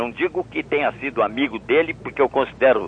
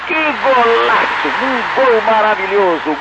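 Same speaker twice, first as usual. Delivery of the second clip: higher, rising goal call; excited goal call; 10 semitones higher than usual. Shown as a demonstration. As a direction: lift the voice as the shot goes in. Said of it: "Faz pra nós, [name]".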